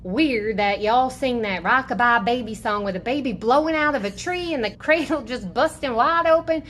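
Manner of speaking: a hint of a sassy Southern mama; lightly voiced Southern advice. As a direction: lay down down-home advice. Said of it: "some good old Southern advice".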